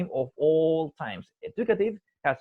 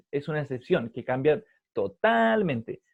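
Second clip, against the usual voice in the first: ordinary talk, nothing marked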